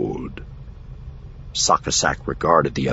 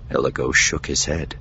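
ordinary delivery

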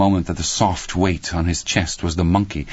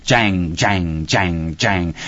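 evenly spaced, ringing "jang" strikes; imitated cymbal clashes; much slower than usual. A narrator's reading. Narrator voices the toy monkey's cymbals clapping, imitated clashes. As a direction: clash like a toy's cymbals, falling each time.

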